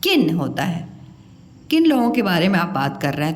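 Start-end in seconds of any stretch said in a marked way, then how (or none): 0.0-0.3 किन with a short, quick i; correct short vowel. Shown as a demonstration. Teacher shows pupils the right word, short i in किन. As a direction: keep the vowel in किन brief. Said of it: "सही उच्चारण है इसका".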